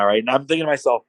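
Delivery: ordinary talk, nothing marked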